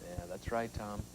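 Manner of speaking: hushed, ominous whisper. Acted. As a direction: breathe it like a secret. Host answers ominously after "what power.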